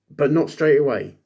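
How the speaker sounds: ordinary talk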